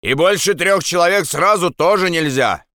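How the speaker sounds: calling out. Acted. Guard calls down conditions to Andres.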